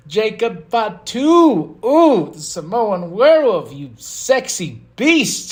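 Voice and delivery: high, loud and drawn-out fan hype; excited fan swooning over a hero; gushing hype. Real, not acted. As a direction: savour each word, stretched and high. Gushing over favourite wrestler.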